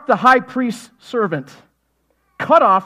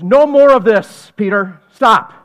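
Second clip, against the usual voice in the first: "No more of this" loud and drawn out slowly, then "Stop"; slow firm command; 10 dB louder, slower than usual. Acted. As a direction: lay down the law slowly. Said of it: "He goes".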